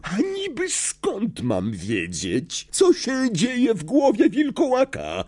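slow start climbing to a high, irate question; hiss. A narrator's reading. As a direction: sneer it out, rising in pitch. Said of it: "syknął Snape". (gniewny syk, coraz wyżej)

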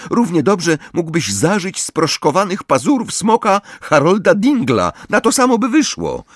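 high-pitched, irritated scolding; exasperated girl telling someone off; snappish. A narrator's reading. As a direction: scold a friend impatiently. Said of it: "warknęła".

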